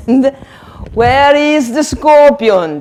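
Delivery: hammed-up search for the scorpion; witch asking. Shown as a demonstration. Teacher playing witch searching.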